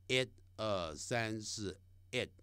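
normal talking voice

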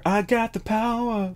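high, slow sung-out message; voicing the spirit's words, high and slow; channeled message. Tarot reader relaying a heard message, high and drawn out. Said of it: "I just heard".

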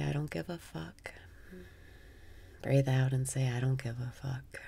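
soft unvoiced whisper. Shown as a demonstration. Whispering the phrase during breathwork. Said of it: "Breathe in and say".

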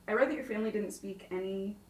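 ordinary talk, nothing marked

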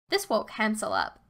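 plain reading voice

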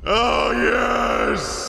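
high, triumphant yell; high gloating cheer; gleeful shout. Real, not acted. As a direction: crow it in triumph. Gloating in game.

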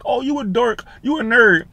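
high, jeering schoolyard taunt; mocking teasing. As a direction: sneer it like a bully. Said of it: "people used to be like".

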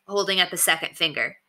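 usual speaking voice

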